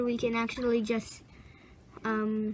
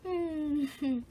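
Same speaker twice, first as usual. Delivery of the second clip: ordinary talk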